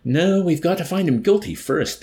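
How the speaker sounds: loud, high restraining objection; cautioning. A narrator's reading. Officer to colonel and major, restraining caution. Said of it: "cautioned with a languid, restraining wave".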